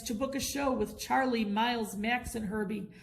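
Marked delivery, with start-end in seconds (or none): none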